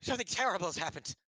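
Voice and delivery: high, fast and loud alarm; panicked stablehand. Acted. Stable boy blurting bad news to the party, fast and frantic.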